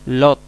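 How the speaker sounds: "Lot" with a very long O; demo pronunciation. Shown as a demonstration. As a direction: stretch the O in "Lot". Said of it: "tipo O súper larga".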